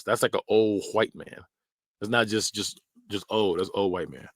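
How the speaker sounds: normal talking voice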